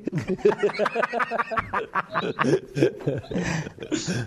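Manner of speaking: big high laugh rolling on; hearty real laughter; long laugh. Real, not acted. Long laugh after joke.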